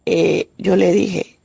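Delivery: usual speaking voice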